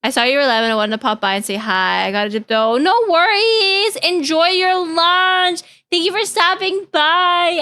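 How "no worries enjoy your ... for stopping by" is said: high, slow sweet send-off; sweet goodbye. Real, not acted. Streamer thanking a leaving viewer, high slow sweet.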